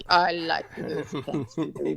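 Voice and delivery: low, slow mock Russian voice; Russian accent. Joking to friends, a slow low Russian accent. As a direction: growl it out like a scary Russian villain. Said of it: "I can't do this voice".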